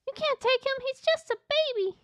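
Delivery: very high pleading character; Ella. Acted. Ella pleads for the baby alien, high voice. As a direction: plead in a squeaky put-on voice.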